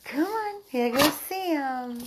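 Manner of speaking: high loud cheer, stretched out slow; drawn-out squeal of encouragement; excited coaxing. Real, not acted. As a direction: cheer the dog on. Cheering dog on.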